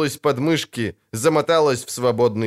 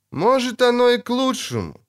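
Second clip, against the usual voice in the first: high-pitched musing; задумчиво; 11 semitones higher than usual. A narrator's reading. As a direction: muse aloud as an unseen onlooker. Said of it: "задумчиво проговорил". (задумчиво проговорить высоким голосом)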